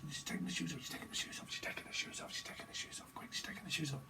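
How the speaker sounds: hushed unvoiced whisper; whispered peeping-tom commentary. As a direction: whisper it like spying.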